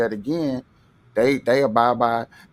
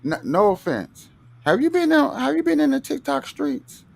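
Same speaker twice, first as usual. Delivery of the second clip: sarcastic; 11 semitones higher, 3 dB louder than usual. Real, not acted. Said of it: "I'm being sarcastic".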